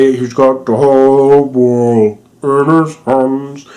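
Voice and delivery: slow, drawn-out creepy sung impression; mock deep voice. Imitating the little kid's creepy deep voice, slowly sung. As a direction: drag out the song like a slowed-down recording. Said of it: "who had the really deep voice".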